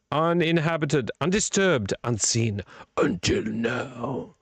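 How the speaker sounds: suspenseful reading, slowing right down at the end; mock horror-trailer voice; trailer tagline. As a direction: build it word by word into an eerie reveal. Reads film tagline like a trailer.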